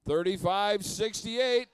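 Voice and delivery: game announcer. Real, not acted. Announcing picked team to crowd.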